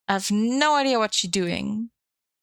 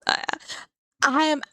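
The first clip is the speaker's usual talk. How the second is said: joke real voice; 7 semitones higher than usual. Pretend. Streamer fakes a real voice for chat, squeaky high voice. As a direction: chirp it like a cartoon girl. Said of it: "do you wanna hear my, my real voice?".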